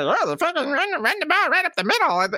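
high, mocking nerd voice; mocking egghead voice. Host mocking the coaches' playbook in a high nerdy voice. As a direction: sneer it like a know-it-all coach. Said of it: "egghead charts that say".

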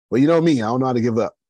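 loud and high, proud comeback; defiant boast. Retold or imitated. Telling his partner he won't quit, loud and proud. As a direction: throw it back at him proudly.

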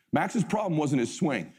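normal talking voice

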